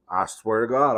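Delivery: normal talking voice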